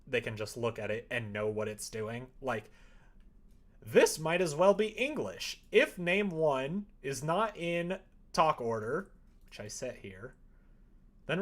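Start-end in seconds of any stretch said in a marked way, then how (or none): none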